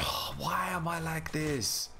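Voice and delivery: high, drawn-out whine at yourself; exasperated, long high moan; mock despair. Real, not acted. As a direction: wail it slowly in comic frustration. Mock despair at own blunder.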